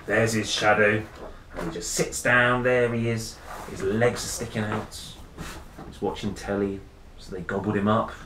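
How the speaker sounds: ordinary delivery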